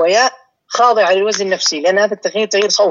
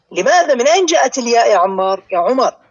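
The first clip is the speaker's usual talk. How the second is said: ordinary delivery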